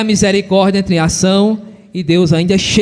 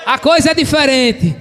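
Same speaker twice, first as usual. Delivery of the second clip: higher, called-out preaching line; raised pulpit voice driving the point home; emphatic proclamation; 4 semitones higher than usual. Real, not acted. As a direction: proclaim it to the congregation. Emphatic repeat to congregation.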